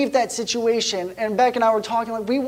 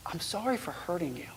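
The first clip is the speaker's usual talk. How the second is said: put-on hushed child apology; 3 semitones lower, 14 dB quieter than usual. Daughter's quiet apology retold.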